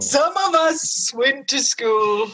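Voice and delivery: high, very drawn-out snooty boast; mock snobbery. Guest rubbing in the co-host's mistake, slow mock snobbery. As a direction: stretch it out with pretend superiority.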